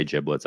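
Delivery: "giblets" with a soft g, like jiblets. Shown as a demonstration. Answering how they say it, "giblets" with a j sound. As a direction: say "giblets" with a j at the front. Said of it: "I say giblets".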